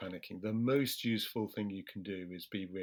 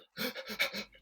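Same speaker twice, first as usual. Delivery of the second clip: high, quick mock gasping breath; mock panting gasp; 12 semitones higher than usual. Demonstrating a panicking person overbreathing, high gasp. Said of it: "goes from sort of".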